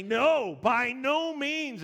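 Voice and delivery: high, loud, slow emphatic denial. Retold or imitated. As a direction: stretch out a firm refusal from the pulpit. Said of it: "Paul answered very emphatically".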